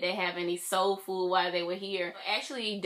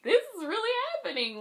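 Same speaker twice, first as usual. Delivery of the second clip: high, swooping squeal of disbelief; emotional disbelief. Retold or imitated. Fan reliving the moment it felt real, emotional disbelief. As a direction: voice soaring as it sinks in.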